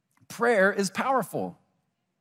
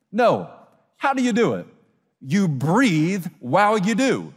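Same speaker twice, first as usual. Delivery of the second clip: ordinary talk, nothing marked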